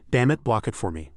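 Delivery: plain reading voice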